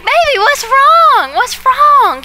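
put-on alarmed parent scooping up a crying baby; worried parent. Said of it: "And we were like".

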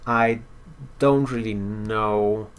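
normal talking voice

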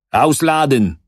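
loud, high shouted order; barked command. A narrator's reading. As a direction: command them to unload. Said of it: "zakomenderował".

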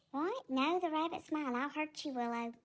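low, soft worried pet voice; timid cat Tigger. Acted. Tigger threatens Willow.

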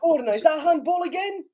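very high, urgent question; excited appeal. Real, not acted. Fan appealing for handball, excited cry. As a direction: cry out for the decision.

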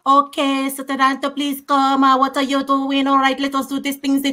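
imitation Italian-accented English, run on in an even tone. Panelist showing how her husband's Italian colleagues talk, imitated Italian accent. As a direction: mimic Italians speaking English in an unbroken stream. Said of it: "You hear them speak their".

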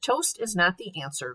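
ordinary delivery, nothing marked

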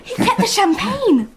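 high, giggly excitement; girl giggling over champagne; laughing delight. Acted. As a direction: laugh as you tell it.

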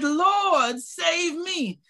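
high, urgent preacher's cry. Acted. Preacher acting Peter's cry to the Lord, high and urgent. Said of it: "He cried".

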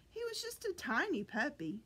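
usual speaking voice

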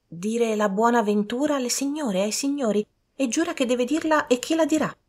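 high-pitched servant voice. A narrator's reading. Servant telling gentlemen what she wants, high servant voice.

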